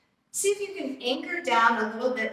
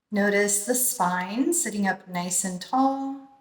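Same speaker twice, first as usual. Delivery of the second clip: guided meditation. Acted. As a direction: ease them into calm breathing, unhurried. Leads a guided breathing meditation.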